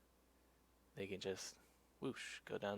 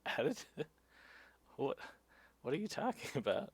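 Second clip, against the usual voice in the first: higher, baffled retort with a laugh; incredulous; 5 semitones higher than usual. Retold or imitated. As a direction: re-enact disbelief at an accusation. Cyclist's baffled reply to cop.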